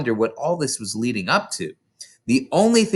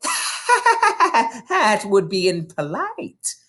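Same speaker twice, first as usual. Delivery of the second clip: slow, high, laughing through the words; crafty grin; 10 semitones higher, much slower than usual. Acted. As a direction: grin slyly as you say it. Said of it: "with a crafty grin".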